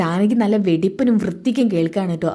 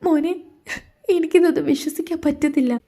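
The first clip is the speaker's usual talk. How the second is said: higher, choked tearful voice, close to crying; tearful mother; 8 semitones higher than usual. Acted. Mother to son after overhearing the plot, tearful. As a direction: heartbroken mother holding back tears. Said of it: "അമ്മ കരയാണോ?".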